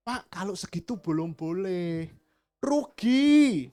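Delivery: mock shopkeeper refusing, high on rugi; playing the stubborn seller; merchant haggling. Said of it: "Pedagangnya bilang apa?".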